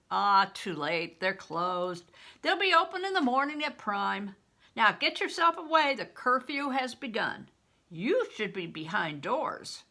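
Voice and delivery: loud, gruff soldier's warning. Acted. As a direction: warn him off, loud and firm. Soldier warns boy at gate.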